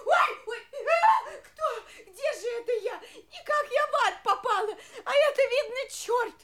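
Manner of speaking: громко, со всхлипами; в панике причитает; испуганный крик с плачем. Acted. Царица в ужасе, думает, что в аду. (испуганный плач)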